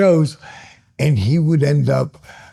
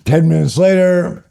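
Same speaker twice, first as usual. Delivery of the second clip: ordinary talk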